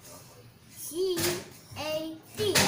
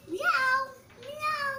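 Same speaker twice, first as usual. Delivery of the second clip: higher playful meowing; cat meow; 6 semitones higher than usual. Pretend. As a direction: mew like a little kitten. Child imitating a cat.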